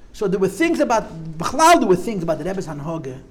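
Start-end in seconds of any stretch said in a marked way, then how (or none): none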